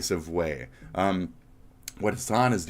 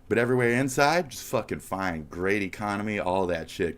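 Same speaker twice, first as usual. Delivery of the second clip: ordinary talk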